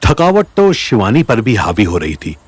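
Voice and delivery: usual speaking voice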